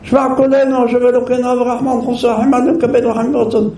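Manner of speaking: high, rushed prayer; hurried prayer. Retold or imitated. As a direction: gabble the prayer impatiently. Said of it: "בנשימה אחת".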